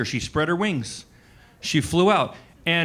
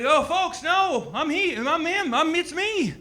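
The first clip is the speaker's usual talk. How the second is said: put-on much higher thin old-man voice; old Biden impression; 14 semitones higher than usual. Mocking Biden's debate reply, much higher and thin. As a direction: squeak it out like a frail old man. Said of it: "And he's like".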